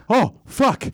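lightly voiced put-out mouse; startled mouse. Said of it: "it looks at me, and it goes".

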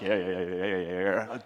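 lightly voiced low, mumbling cousin; a hint of tongue-tied country cousin, low; awkward fisherman cousins. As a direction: impersonate uneasy cousins in a low voice. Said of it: "They're like".

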